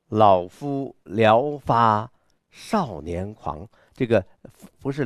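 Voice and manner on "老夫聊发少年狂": very slow, drawn-out, proud; confident bold recital. Shown as a demonstration. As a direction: stretch out the line with self-assured pride. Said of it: "他的这种狂是很有阵势的". (自信豪迈地慢读)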